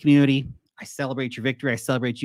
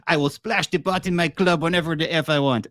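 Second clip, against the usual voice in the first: higher, hammed-up Russian accent; terrible Russian accent; 5 semitones higher than usual. Quoting the poker scene from Rounders, mock Russian accent. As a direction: play a defiant poker boss in bad Russian. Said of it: "Terrible Russian accent".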